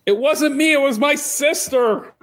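high, slow, whiny denial; squeaky blaming girl. Acted. Bathhouse girl to the demon, high whiny denial. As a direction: shift the blame in a tiny high voice.

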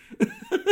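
short high-pitched laugh. Real, not acted. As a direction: burst out laughing.

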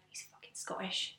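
slow hushed whisper of shock. Retold or imitated. Whispering to her friend during his call, hushed shock. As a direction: breathe it so he can't hear.

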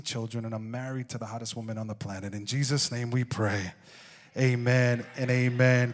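low, earnest prayer voice. Acted. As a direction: pray over the church. Leads a prayer.

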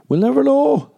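very high, loud squeal; mock-mystified high squawk; silly high cry. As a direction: shriek it playfully, far above normal pitch. Silly squeal, then repeated normally.

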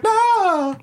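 high, stretched-out nah; drawn-out protest. Retold or imitated. Imagined [name] fan objecting online, drawn-out high protest. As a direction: wail it long in mock outrage. Acting out a stunned fan's reply.

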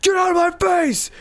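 high-pitched hammed-up angry outburst; mock yelling. Imitating the prankster yelling at his girlfriend, mock yell. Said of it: "yelling at this lady".

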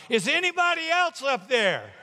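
high, loud shout skyward; desperate yell. Acted. Tourist rejecting the advice, calling to heaven, yelling. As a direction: yell past him for another helper. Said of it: "the tourist yelled".

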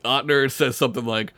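ordinary talk, nothing marked

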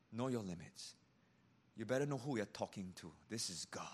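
fast then whispered This is God; a soft awed whisper; hushed warning. Acted. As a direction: drop to a whisper on the name. Solemn warning about God.

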